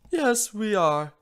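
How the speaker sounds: slow, tender reply; soft. Acted. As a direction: say it gently. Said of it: "she said softly".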